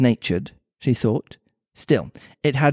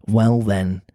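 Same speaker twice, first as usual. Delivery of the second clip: put-on Mancunian accent; 4 semitones lower than usual. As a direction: lower Mancunian cat voice. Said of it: "pretty Mancunian sounding accent".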